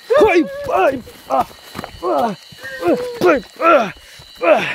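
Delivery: high loud panicked screams; startled shrieks in the dark; frightened screams. Real, not acted.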